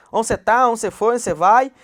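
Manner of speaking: loud Goiás-style Onde cê tá, Onde cê foi, Onde cê vai; Goiás way of talking. Shown as a demonstration. Teacher repeating the Goiás way of asking. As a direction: repeat the Goiás questions, just as lively. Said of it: "Tudo do mesmo jeito".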